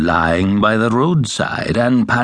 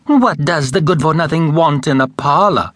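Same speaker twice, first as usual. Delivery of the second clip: higher, scornful jeer; sneering stepsister; 7 semitones higher than usual. A narrator's reading. Stepsisters mocking the poor girl, lightly sneering. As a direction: look down your nose at her.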